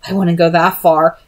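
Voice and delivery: ordinary delivery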